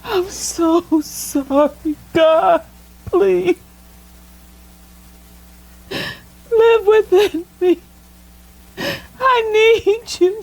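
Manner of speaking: high, loud, broken sobbing pleas to God; sobbing prayer. Real, not acted. Woman crying out to God for forgiveness, sobbing hard. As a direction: weep out every word, begging forgiveness.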